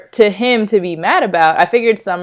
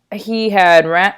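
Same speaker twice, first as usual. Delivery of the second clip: ordinary delivery, nothing marked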